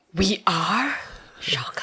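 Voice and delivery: hushed mock surprise; mock whisper shock. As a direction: gasp it out in fake shock. Feigned shock at reveal.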